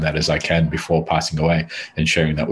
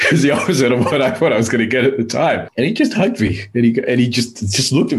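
laughing; 6 semitones higher than usual. Real, not acted. Laughing retelling the hug.

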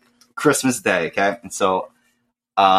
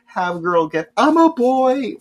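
high, wailing mock-tantrum scream; mock crying scream; 11 semitones higher than usual. Retelling his childhood tantrum, high wailing mock scream. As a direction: wail it like a heartbroken kid. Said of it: "I'm crying, screaming".

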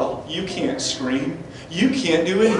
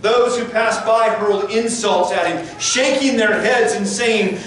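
raised, intense preaching read; fervent scripture reading; 6 semitones higher than usual. Acted. Preacher reading the crucifixion to the congregation, raised and fervent. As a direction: build the scene with urgent fire. Preacher reads the mocking scene.

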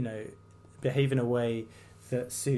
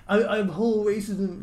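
higher, louder pompous mock voice; mock denial; 8 semitones higher, 5 dB louder than usual. Vlogger mocking the councillor's denial, higher pompous mock. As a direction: parody the self-righteous denial. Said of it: "Whereas, like".

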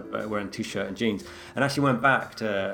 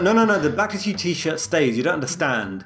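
higher, louder mock protest; indignant protest; 6 semitones higher, 4 dB louder than usual. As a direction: insist the t-shirt stays, hammed-up. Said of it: "Literally, I was like".

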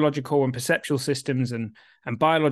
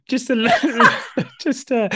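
laughing; 9 semitones higher, 5 dB louder, slower than usual. Real, not acted.

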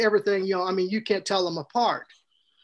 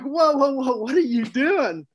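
startled whoa; 5 semitones higher than usual. Retold or imitated. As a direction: react like you just walked into a mess. Researchers find boy covered in manure.

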